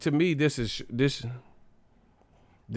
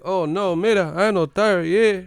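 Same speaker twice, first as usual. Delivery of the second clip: put-on Cuban accent; 9 semitones higher, 5 dB louder than usual. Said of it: "I'd be like".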